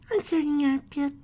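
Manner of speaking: high, gentle little filly voice; reassuring. Acted. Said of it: "trying to cheer him up".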